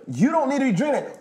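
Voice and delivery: high, loud, firm telling-off; to the friend holding the energy drink, loud high scolding; heated scolding. Retold or imitated. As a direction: raise your voice, stop him drinking it.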